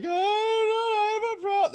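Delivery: high-pitched, loud fake bawling; hammed-up drunken teenage cry; mock wailing. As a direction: wail it out so the parents come. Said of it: "wailing in my room".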